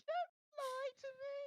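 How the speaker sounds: slow, loud, very high wail; tearful gasp. A narrator's reading. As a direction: wail the accusation through mock tears. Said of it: "Myrtle gasped, tears now flooding".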